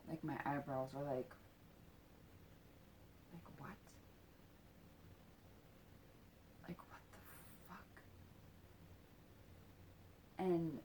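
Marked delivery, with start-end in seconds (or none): 3.3-8.0 retold under-the-breath disbelief, nearly whispered; very soft, breath-only mock outrage; incredulous anger. Child reacting to what he is told, quietly incredulous. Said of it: "I get mad".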